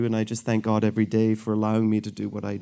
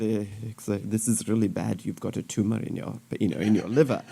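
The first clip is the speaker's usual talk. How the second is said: put-on African accent; 3 semitones lower, 6 dB quieter than usual. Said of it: "they're from Africa".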